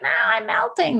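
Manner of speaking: high, wailing melting-witch impression; mock-dramatic witch's wail. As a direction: play a witch shrinking away, pitched well up. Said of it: "like the witch when you pour water on her".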